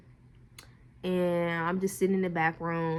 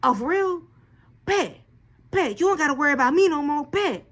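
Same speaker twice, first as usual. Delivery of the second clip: high mock offended man; 7 semitones higher, 5 dB louder than usual. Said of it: "he was like".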